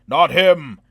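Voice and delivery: loud high desperate yell; shouting. A narrator's reading. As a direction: shout to save the dwarf. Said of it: "Carl shouted".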